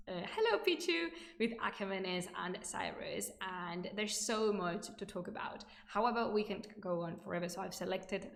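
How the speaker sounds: ordinary delivery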